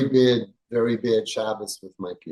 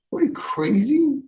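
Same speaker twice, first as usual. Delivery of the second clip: much higher, stunned disbelief; incredulous outburst; 12 semitones higher than usual. Real, not acted. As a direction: throw the question out as if it's absurd. Rabbi incredulous at parent's fight.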